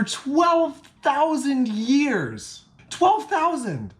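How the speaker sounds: high, drawn-out disbelief; amazed emphasis. Real, not acted. Vlogger tells viewers how old the solstice is, astonished. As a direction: stretch the huge number in rising astonishment.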